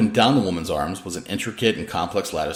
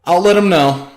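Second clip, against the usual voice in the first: raised, higher dry reply; called reply; 11 semitones higher, 10 dB louder than usual. A narrator's reading. Lori calls back to Danny, loud dry reply. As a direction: toss it back loudly. Calling back between rooms.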